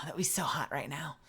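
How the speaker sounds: breathy sultry whisper over food. Pretend. Whispering to the chat about butter, sultry. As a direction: whisper it like a seduction.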